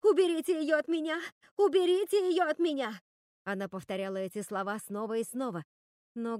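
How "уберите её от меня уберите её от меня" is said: high, loud, repeated plea; panicked shouting. A narrator's reading. Руби begs for Готель to be taken away, shouting in panic. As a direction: cry desperately for rescue.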